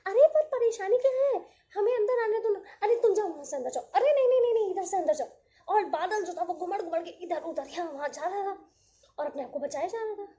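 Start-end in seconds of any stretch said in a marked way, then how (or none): 0.1-5.3 high, loud, fast pleading of tiny raindrops; pleading little droplets. Acted. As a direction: beg to be let in like tiny drops.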